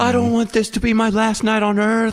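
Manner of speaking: high-pitched mock panicking kid; child freaking out. Son fearing he is dying, hammed-up freak-out. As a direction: wail it like a terrified little boy. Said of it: "And then he freaks out".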